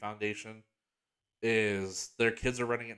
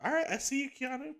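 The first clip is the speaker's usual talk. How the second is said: much higher, gushing excited squeal; fangirl reaction. Retold or imitated. Fan reacting to Keanu's trailer line, high excited squeal. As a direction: swoon at the trailer like a thrilled fan. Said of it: "it's like".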